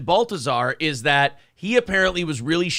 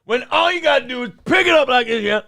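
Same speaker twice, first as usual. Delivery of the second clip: P D Green shout; 7 semitones higher, 4 dB louder than usual. Pretend. Repeats the bit.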